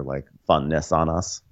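normal talking voice

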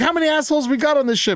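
hammed-up captain shouting a quick question; shouting officer. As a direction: rattle it off like a furious captain. Acting out officer.

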